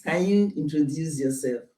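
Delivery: usual speaking voice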